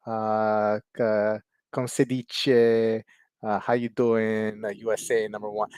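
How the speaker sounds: slow, hammed-up Italian accent. Italian kid's letter to an American pen pal, Italian accent. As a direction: imitate an Italian pen pal, dragging the words out. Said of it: "read it in his Italian accent".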